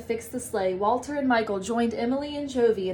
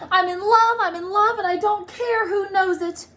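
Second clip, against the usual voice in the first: gushing, high and loud, bursting with joy; excited; 9 semitones higher, 4 dB louder than usual. A narrator's reading. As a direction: shout your love to the whole room. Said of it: "Buddy was so excited".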